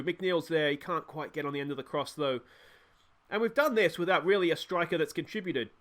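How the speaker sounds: ordinary talk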